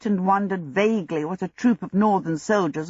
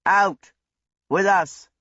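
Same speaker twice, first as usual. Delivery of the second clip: mildly barked order, short phrases; snapped; 4 dB louder, slower than usual. A narrator's reading. Squad leader arresting Abdullah, snapped with moderate force. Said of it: "snapped the squad leader".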